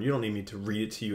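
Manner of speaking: normal talking voice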